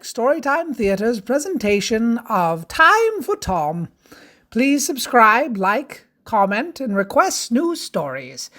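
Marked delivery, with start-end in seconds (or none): none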